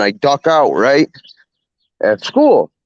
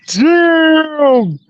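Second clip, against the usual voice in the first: very high, drawn-out mock gasp of a word; hammed-up amazed teachers; shocked exclamation. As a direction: play the teachers stunned at the sight. Teachers see the beaten kid.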